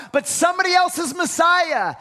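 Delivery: loud, high preaching shout; emphatic shout. Real, not acted. Preacher shouting the point to the church. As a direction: drive the point home with a shout.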